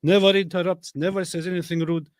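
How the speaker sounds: high, hammed-up lecturing critic; mock scolding critic. Mocking what critics tell him.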